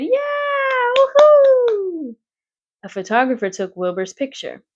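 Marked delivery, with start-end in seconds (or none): 0.0-2.2 high loud whoop; cheering. A narrator's reading. Crowd cheering the prize winner, high loud whoop.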